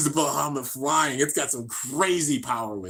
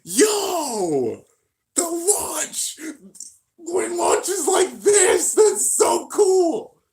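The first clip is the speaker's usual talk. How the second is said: much higher, louder, slow stretched yelling; ecstatic shout; 6 dB louder, much slower than usual. Real, not acted. Watching Gwen's launch, ecstatic slow shouting. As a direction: scream it like a thrilled fan.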